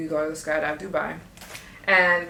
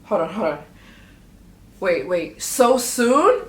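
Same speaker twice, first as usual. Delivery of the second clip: startled surprise; 3 semitones higher, 7 dB louder, slower than usual. Retold or imitated. Told to jump tomorrow, rising in startled surprise. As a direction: caught off guard and pitching up. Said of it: "I was just like".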